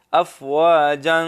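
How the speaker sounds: افواجن with a clear final ن, drawn out; joined reading. Shown as a demonstration. Teacher shows the joined reading with nunation. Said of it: "دو زبر وہ پھر پڑے".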